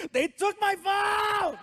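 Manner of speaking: high, slow, crying yell; a teenager's anguished shout over his phone; yelling teen. Retold or imitated. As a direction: wail it at the floor. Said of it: "he yells at the ground".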